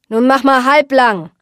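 irritated skipper telling someone off; annoyed skipper. Acted. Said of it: "Der Skipper stemmte ärgerlich die Hände".

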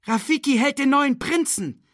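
high, jubilant announcement; high-pitched onlooker's call; excited crowd cry. A narrator's reading. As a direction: shout the news across the crowd. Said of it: "rief jemand".

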